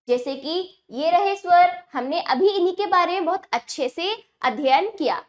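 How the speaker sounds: usual speaking voice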